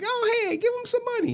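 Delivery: very high-pitched grandma voice; high, coaxing mock grandma; grandma impression. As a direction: imitate grandma piping up on your behalf. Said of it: "my grandma like".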